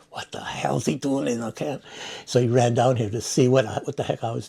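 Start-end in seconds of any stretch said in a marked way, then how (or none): 0.1-1.8 a hushed, whispered "who's this guy" question; whispered suspicion. Retold or imitated. Retelling Chuck Hill spotting him, whispered suspicious question. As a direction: whisper it as if sizing up a stranger.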